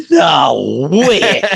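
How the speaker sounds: drawn-out, incredulous exclamation; retold disbelief. Telling of learning about the Great Lakes, incredulous. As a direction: stretch it out in mock disbelief. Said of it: "And I'm like".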